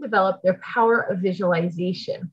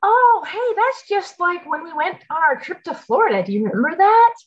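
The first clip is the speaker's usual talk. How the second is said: bright, much higher excited "oh hey"; excited parent; 7 semitones higher than usual. Shown as a demonstration. Mom to kids recalling a trip, excited high voice. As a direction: sound delighted by the memory. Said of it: "So you can say things like".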